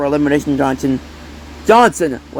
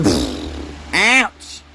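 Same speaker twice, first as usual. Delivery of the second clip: ordinary talk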